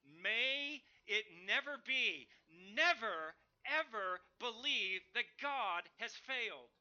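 loud, slow, drawn-out outcry; emphatic preacher's cry of never; utter exclamation. Retold or imitated. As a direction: thunder it as Paul's shocked denial. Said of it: "an utter exclamation".

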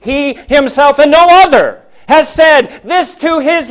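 "he himself" pressed high and loud; emphatic. Real, not acted. Preacher stressing to the church that it is "he himself", said high and loud. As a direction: lean hard on "he himself and no other". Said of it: "emphatic in the Greek".

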